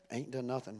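quiet echo. Acted. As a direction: drop it low and soft as an aside.

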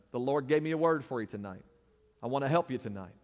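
usual speaking voice

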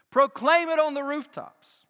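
high, loud and ringing; bursting out after the whisper; proclaimed loudly. Shown as a demonstration. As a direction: shout it from the rooftops. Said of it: "what you hear in a whisper".

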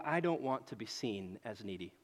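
low, soft muttered inner thought; quiet inner voice. Retold or imitated. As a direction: say it to yourself, hushed and low. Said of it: "I look at this and I go".

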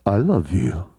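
very deep, booming voice of God; mock divine bass rumble; God's voice. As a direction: drop way down and sound like the Almighty. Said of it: "'Cause that's how I think God sounds".

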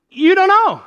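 high, loud squeal; exasperated exclamation. Acted. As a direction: throw it out loudly, way up high. Preacher exclaims over unprovable claim.

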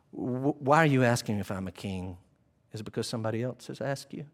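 low, soft and measured; composed Jesus, hushed and low; calm, quiet questioning. Acted. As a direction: answer very calmly, pitched far down. Jesus calmly questions agitated Pilate.

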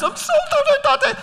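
put-on very high, tiny childish voice saying sorry; high little-boy apology; small boy apologising. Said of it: "And he's".

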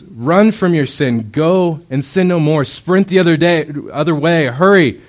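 loud, high, urgent call to run; urgent command. Acted. God urging sinners to flee, loud urgent shout. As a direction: shout it like a desperate warning. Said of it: "God goes".

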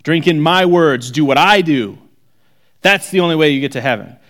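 mock arrogant demand; arrogant self-proclaimed messiah. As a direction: play a pompous preacher demanding worship. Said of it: "like me getting up here and going".

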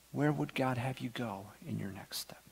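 hushed question, voiceless; whisper. Real, not acted. Pastor asking the congregation a searching question, whispered. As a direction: whisper it so each listener leans in.

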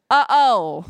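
loud mock-worried uh-oh; the enemy's alarm. Preacher as the enemy seeing trouble coming, hammed-up alarm. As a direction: play a devil caught out, loudly. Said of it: "because the enemy remembers".

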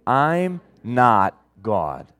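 "I'm not God." said very slowly, stress on "I'm"; slow repeated affirmation. Shown as a demonstration. Pastor repeats "I'm not God." with the church, very slowly. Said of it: "Let's say it again".

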